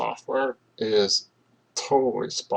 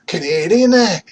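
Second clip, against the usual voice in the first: high, loud hammed-up Canadian "Canadian, eh?" with the "eh" tacked on; jokey Canadian accent on "Canadian, eh?", high and loud; mock Canadian accent; 8 semitones higher, 12 dB louder than usual. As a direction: belt "Canadian, eh?" as a Canadian caricature. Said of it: "we have Canadians that say".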